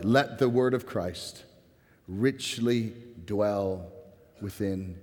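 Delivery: measured scripture reading that sinks quietly at each close. Real, not acted. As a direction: read it word by word, with weighty pauses. Reads the scripture verse aloud twice.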